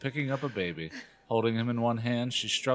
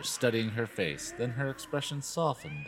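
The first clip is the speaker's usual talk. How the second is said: ordinary delivery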